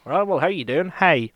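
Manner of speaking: sing-song rural Northern Irish culchie accent. Shown as a demonstration. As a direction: imitate a culchie accent with emphasis on "Hey". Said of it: "a bit more culty aspect would be like".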